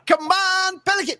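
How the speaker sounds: high squeaky imitation of the cheer; mock cheer. Host teases the guest by echoing his cheer very high. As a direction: pitch it way up, teasing.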